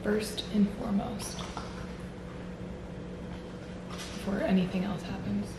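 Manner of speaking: hushed secret reveal; whispering. Real, not acted. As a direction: lean in and whisper. Whispered reveal of name tag.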